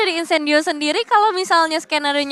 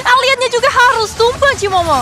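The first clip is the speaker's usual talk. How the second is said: esports caster; 8 semitones higher, 6 dB louder than usual. Real, not acted. Teamfight climax call.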